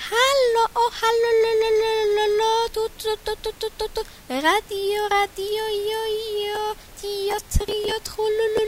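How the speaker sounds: high, trilling birdlike calls; nightingale song. Acted. Nightingale trilling his radio concert to the forest. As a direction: sing out like a showy nightingale on air. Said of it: "Pierwszy słowik zaczął tak".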